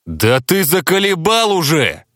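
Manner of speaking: high-pitched, fed-up explosion; exasperated outburst. A narrator's reading. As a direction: blow up at a friend who has tested your patience. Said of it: "взорвался я".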